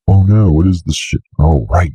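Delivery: normal talking voice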